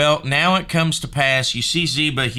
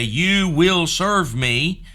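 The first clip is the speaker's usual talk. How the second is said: slow, drawn-out higher-pitched order; mock a demanding king, stretching each word; imagined command; 5 semitones higher, 3 dB louder, slower than usual. Imagined demand the king never makes.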